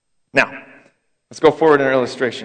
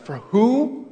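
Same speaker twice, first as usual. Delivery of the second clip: higher, startled question; surprised friend; 8 semitones higher than usual. Retold or imitated. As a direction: friend caught off guard by the name. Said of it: "And he's like".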